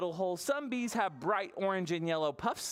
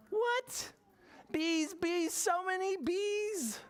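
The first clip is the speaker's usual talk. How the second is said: put-on much higher, alarmed child voice; shocked; slower than usual. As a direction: squeal it out in disbelief. Kaya shocked by rooftop bees.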